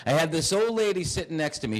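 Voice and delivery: ordinary talk, nothing marked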